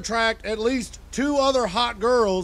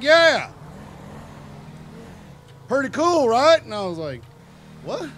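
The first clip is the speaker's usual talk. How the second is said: Bud; 3 semitones higher, faster than usual. Retold or imitated. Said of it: "And he was like".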